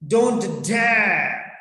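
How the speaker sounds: "Don't dare" called out loud, tune lifting; rising tone demo. Shown as a demonstration. Teacher shows a rising tone with "Don't dare", called out. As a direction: snap it out as a warning. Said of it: "Rising tone".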